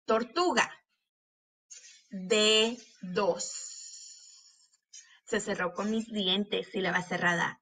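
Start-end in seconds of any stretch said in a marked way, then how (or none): 2.1-3.7 "Dedos" slowly as de, pause, dos; split into syllables. Shown as a demonstration. Teacher showing teeth closing the syllable, syllable by syllable. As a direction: separate both syllables of "Dedos". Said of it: "para ver cuáles de esas sílabas".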